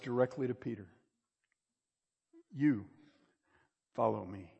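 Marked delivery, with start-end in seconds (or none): 2.5-4.5 "You" set apart by a long pause, then a lower "follow me"; weight on "You" as a word of its own; stress on You. Retold or imitated. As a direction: isolate the word "You", then let the rest drop. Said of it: "The word "you" is emphatic".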